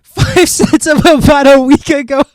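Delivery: loud, high burst of laughter; cracking up while talking; laughing hard. Real, not acted. Cracking up at the clip.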